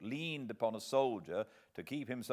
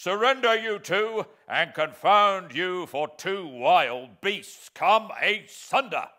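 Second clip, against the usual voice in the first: loud, slow, high barked order; commanding shout; 9 semitones higher, 13 dB louder, slower than usual. Acted. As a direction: order them apart with full authority. Sergeant orders struggling men.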